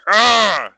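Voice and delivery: loud high roar of frustration; frustrated roar. Retold or imitated. A taunted enemy player roaring in frustration. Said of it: "They're gonna be like".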